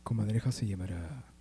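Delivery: low seductive sultry voice; sensual voice. Pretend. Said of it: "dilo con voz sensual".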